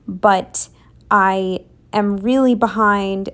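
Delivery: ordinary talk, nothing marked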